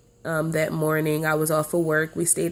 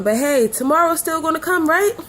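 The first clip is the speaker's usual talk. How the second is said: high, louder, breezy optimism; telling herself it'll be fine, high and upbeat; falsely hopeful; 9 semitones higher, 4 dB louder than usual. Retold or imitated. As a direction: lift it up, brushing worry off. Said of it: "thinking in the back of my mind".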